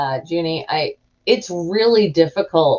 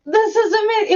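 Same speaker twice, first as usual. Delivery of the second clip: much higher, almost teary joy; relieved delight. Retold or imitated. After getting the cheap pill, giddy teary relief. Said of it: "And I was like".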